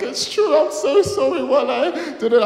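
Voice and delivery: high, loud mock-weeping apology; imitated crying. Girl apologising to the boy she used, mock crying. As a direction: wail it in an exaggerated tearful squeal. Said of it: "she just started crying".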